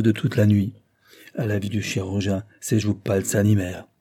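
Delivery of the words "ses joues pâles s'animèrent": plain reading voice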